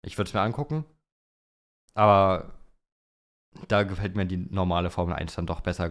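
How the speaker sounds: ordinary talk, nothing marked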